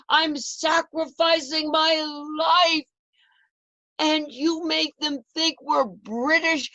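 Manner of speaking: high, loud furious outburst; angry yelling. Retold or imitated. Husband yelling at his wife over the petticoat, furious high shout. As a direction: shout it out in shocked fury, voice cracking. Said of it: "he started yelling at me and said".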